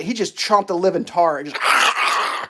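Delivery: usual speaking voice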